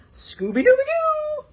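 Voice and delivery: very high joyful cry; Scooby exclaiming. Acted. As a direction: howl it out happily. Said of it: "Scooby exclaimed".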